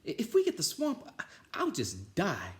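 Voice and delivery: tremble with dread of the swamp; high fearful stammer; nervous kid. Acted.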